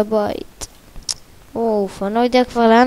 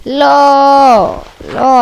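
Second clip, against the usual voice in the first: loud, high cry of no; sharp no; 5 semitones higher, 8 dB louder, much slower than usual. Real, not acted. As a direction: shout the no as it goes wrong. Sudden outburst while playing.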